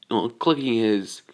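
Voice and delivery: usual speaking voice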